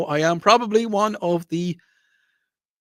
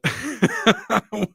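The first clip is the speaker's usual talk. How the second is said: amused laugh; 7 semitones higher than usual. Real, not acted. Laughs at his story.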